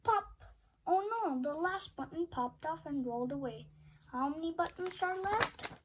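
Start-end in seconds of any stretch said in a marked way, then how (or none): none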